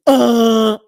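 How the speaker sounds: long, loud drawn-out "uh"; imitated joke punchline noise. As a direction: groan out a big dragged noise as a punchline. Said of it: "And he'd go".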